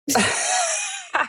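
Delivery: high squealing laugh. Real, not acted. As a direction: burst into a high delighted laugh. Mother laughing at daughter's story.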